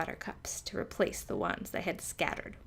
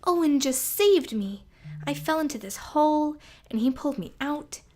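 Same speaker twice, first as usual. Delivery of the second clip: high, loud excited rush; burst out; 8 semitones higher, 8 dB louder than usual. A narrator's reading. Mouse telling her friend about the rescue, bursting out. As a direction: blurt it all out thrilled. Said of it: "Sophie burst out".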